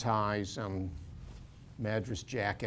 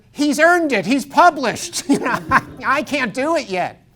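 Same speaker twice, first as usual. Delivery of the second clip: excited laughing quip; 12 semitones higher, 13 dB louder than usual. Retold or imitated. Joking punchline at party.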